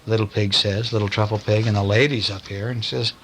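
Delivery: usual speaking voice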